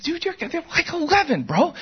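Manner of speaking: can't-believe-it pushback to the coach; incredulous protest. Retold or imitated. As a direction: parent telling the coach the kids are eleven, high incredulous. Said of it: "I'm like".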